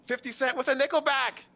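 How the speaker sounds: high, loud shout after someone; calling out. Acted. Game master voices a character shouting after someone, calling out. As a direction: call out high and insistent across the street.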